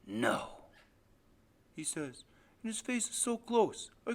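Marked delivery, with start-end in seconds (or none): 0.1-0.6 low, heavy refusal; menacing father. Acted. Father rejects his son's memory, low menace. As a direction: refuse it darkly, deep in the voice.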